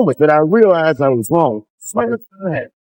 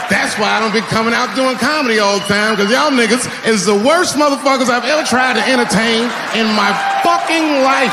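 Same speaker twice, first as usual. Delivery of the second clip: mock rant; 6 semitones higher than usual. Real, not acted. Comic yells at audience.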